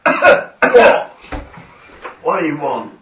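loud, high-pitched creature outburst; troglodyte prisoner. Acted. Captured troglodyte answering its questioner, startled loud demand. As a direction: yell it back at the interrogators.

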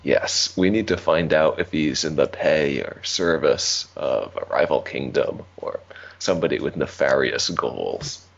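normal talking voice

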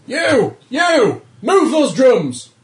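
high, loud officer's bellow; single out each hand with a yell, drawing out the command; shouted orders. Acted. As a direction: bark it across the deck at full voice. First officer shouting orders at crew.

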